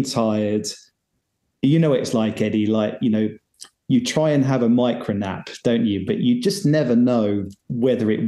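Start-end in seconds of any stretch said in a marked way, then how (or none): none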